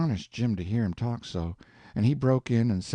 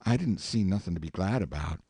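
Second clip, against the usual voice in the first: plain reading voice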